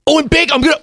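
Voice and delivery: high-pitched mock enthusiasm; excited rush of words. Host imitating the co-host's enthusiasm, high excited gabble. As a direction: imitate someone tripping over their own excitement. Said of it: "thinking so fast and the words aren't coming out".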